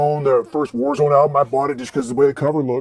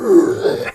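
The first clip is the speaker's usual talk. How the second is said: high burst of laughter. Real, not acted. Laughing at the prank.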